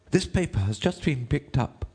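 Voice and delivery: high, deferential courtier. A narrator's reading. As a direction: address the king in a high rabbit voice.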